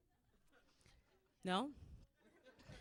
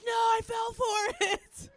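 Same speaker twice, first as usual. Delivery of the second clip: mock wail; 11 semitones higher, 9 dB louder, slower than usual. Refusing patient's trick, yelling.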